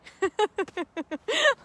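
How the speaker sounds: loud amused laugh; high laugh. Real, not acted. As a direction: crack up laughing. Laughs at own joke.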